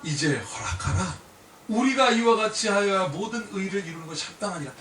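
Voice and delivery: measured, solemn Bible reading; scripture reading. Acted. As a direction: read the verse with weight. Preacher reads scripture aloud.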